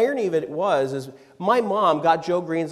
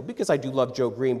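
usual speaking voice

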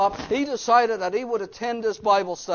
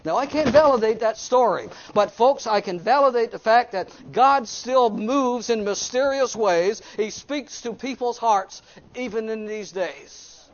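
raised, emphatic sermon delivery; preaching; 5 semitones higher, 5 dB louder than usual. Real, not acted. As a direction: drive the lesson home to the congregation. Preaches the moral at force.

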